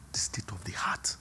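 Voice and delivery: whispered, hushed emphasis; a breathy whisper of the key phrase. Real, not acted. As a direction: drop to a whisper to make it sink in. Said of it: "sudden whisper".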